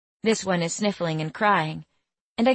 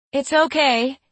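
high, louder reassuring call; 8 semitones higher, 5 dB louder than usual. A narrator's reading. Harlow calls out to the screaming woman, high and loud. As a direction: call out over the commotion. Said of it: "Harlow calls out".

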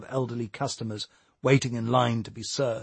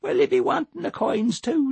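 put-on amused old man; 14 semitones higher than usual. Mister Wallace amused.

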